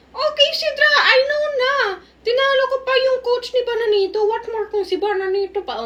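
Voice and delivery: put-on kid voice. Voicing kid Sidra in roleplay.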